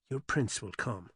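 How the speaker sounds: teasing. A narrator's reading. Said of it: "Alice teased him".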